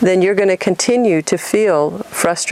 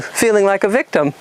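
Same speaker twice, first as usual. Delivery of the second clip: ordinary talk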